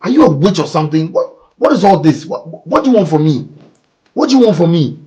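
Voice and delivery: loud, high exasperated yelling; angry shouting. Acted. Man yelling at Bella over the pregnancy, furious. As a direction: yell in disbelief and fury.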